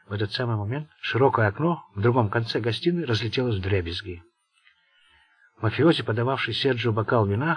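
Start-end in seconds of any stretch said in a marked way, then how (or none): none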